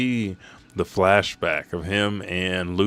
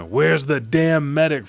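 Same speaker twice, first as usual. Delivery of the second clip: higher, louder, slowed-down angry demand; angry shout; 8 semitones higher, 6 dB louder than usual. Retold or imitated. Brook demands the missing medic, angry shout. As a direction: bark it at the empty doorway.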